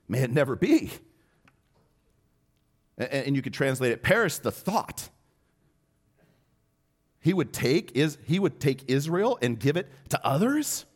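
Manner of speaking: high, loud horrified disbelief; shocked protest. Acted. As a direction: recoil at the very idea. Leaders shocked reply.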